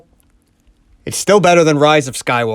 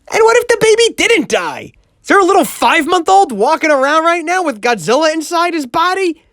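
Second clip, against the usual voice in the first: much higher, mock-alarmed question; high squeaky voice; 10 semitones higher than usual. Absurd worried question.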